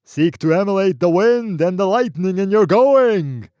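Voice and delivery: high, loud booming shout; wild booming cry. Acted. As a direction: whip him onward with the voice. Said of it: "cried the wild, booming voice".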